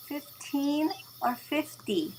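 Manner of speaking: retold double-check, slow on Fifteen or fifty; pointed clarification. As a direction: carefully spell out the contrast between Fifteen and fifty.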